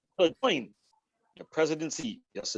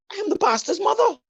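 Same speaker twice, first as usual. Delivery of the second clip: put-on old lady's punchline, much higher and louder; proud old lady; 5 dB louder than usual. Joke teller voicing the old lady's proud boast to the usher. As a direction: announce it like a proud, indignant grandmother.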